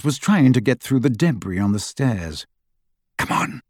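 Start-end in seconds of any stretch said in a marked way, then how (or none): 3.2-3.6 soft, hushed urging; whisper. A narrator's reading. Harry urging his friends to escape, whispering. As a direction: whisper it under cover. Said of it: "Harry whispered".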